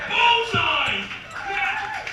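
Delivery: high, loud stage shout; big high-pitched yell. Real, not acted. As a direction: shout it out to the whole crowd. Compère shouting to crowd on stage.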